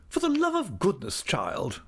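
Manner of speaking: very high-pitched imperious outburst; exclaiming, exasperated aunt. A narrator's reading. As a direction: scold the youngster in a high aunt voice. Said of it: "exclaimed my aunt".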